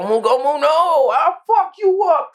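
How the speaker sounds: hammed-up high-pitched battle yell; mock anime attack cry. Joking as Oda defending his work, mock anime attack cry.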